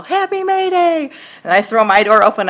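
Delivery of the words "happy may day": high, drawn-out singsong call; children's yell. Retold or imitated. Kids on a May Day walk calling at her door, singsong yell. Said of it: "and they yell".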